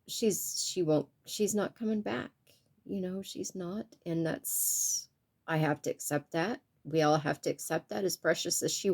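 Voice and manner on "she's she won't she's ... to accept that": much softer, barely holding together; real grief, voice dropping to almost nothing; choked-up grief. Real, not acted. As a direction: let the voice nearly break and fade. Grieving her mother, voice drops away.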